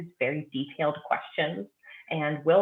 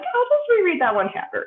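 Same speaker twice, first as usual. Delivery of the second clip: high self-mocking squeak; high, light mock voice; 8 dB louder than usual. Teasing herself about rereading, high mock voice. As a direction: pitch it way up, airy. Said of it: "I'm like".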